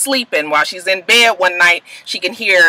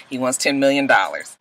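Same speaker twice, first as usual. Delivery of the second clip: ordinary talk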